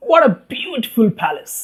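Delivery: high admiring exclamation, softening at the end; marvelling to himself. A narrator's reading. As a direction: marvel high, then let it fall away. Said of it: "thought Abiram".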